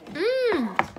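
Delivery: high, loud savoring mmm. Real, not acted. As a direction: relish the taste aloud. Tasting the donut.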